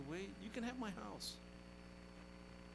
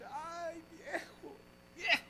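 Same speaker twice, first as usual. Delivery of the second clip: widow's lament; 6 semitones higher than usual. Retold or imitated. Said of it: "And she said".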